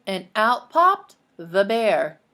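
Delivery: slow, loud reveal; dramatic reveal. A narrator's reading. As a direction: land the surprise slowly. Reading the big reveal.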